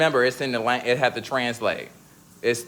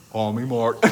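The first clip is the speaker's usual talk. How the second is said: a lightly lowered, covert man's voice; stealth man voice; 2 semitones lower than usual. Shown as a demonstration. Joking to friends, lightly playing a man called Mark. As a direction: sneak it out like a man in disguise. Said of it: "I'm doing my stealth".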